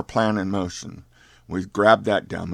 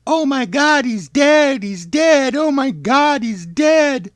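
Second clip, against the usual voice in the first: loud, very high screaming woman; Marcia Meadows; 7 dB louder, slower than usual. Acted. Lady passenger sees the body hit, hysterical high screaming. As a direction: wail it out in a high-pitched put-on lady's scream. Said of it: "using my Marcia Meadows voice there".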